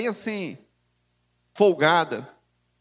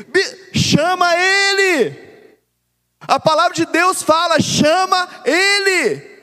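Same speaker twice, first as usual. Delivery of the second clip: much higher, loud, stretched "chama ele"; preaching at full force; 8 semitones higher, 6 dB louder, slower than usual. Acted. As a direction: thunder it from the pulpit. (pregação inflamada, gritando "chama ele")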